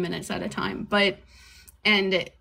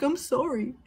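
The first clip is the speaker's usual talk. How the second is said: higher, pleading mock apology; imitating dad's plea; 6 semitones higher than usual. Dad on the phone begging his daughter, mock pleading. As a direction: play the dad begging, pitched up. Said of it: "crying on the phone".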